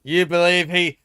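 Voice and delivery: loud, high hammed-up Aussie accent; mock Aussie accent. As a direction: shout it like a rowdy Aussie bloke. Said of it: "Tipping a bit into Aussie".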